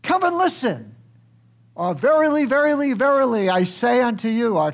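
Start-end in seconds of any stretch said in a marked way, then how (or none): 0.0-0.9 high, raised call to the crowd; crying out. Retold or imitated. Jesus calling the temple crowd to listen, raised high cry. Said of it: "he would cry out". 1.9-4.6 high and loud solemn proclamation; crying out. Retold or imitated. Jesus crying out a teaching to the crowd, loud and high. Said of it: "he would cry out".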